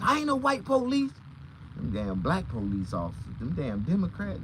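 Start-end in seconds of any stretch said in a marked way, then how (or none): 0.0-1.1 indignant cop. Retold or imitated. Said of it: "he said".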